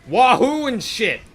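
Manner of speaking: loud, high, drawn-out wahoo; Mario cheer. Acted. Streamer kicking off Mario Day, Mario cheer. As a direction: whoop it slowly like a game hero. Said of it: "let's talk about fucking Mario".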